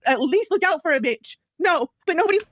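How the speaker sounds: high, strained voice near tears; distraught rant. Real, not acted. Ill streamer venting to hosts, high and distraught. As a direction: pour out the hurt, pitch climbing.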